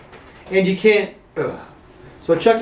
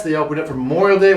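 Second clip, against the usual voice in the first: ordinary talk, nothing marked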